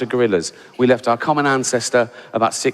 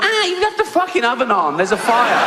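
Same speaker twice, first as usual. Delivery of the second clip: very high, laughing squeal of fake alarm; squealing mock panic; 9 semitones higher than usual. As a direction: shriek it like a giddy inmate.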